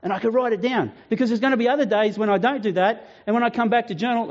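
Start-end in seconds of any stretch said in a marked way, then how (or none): none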